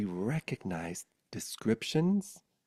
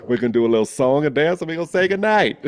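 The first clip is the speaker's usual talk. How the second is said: playful announcement; 7 semitones higher, 13 dB louder, faster than usual. Real, not acted.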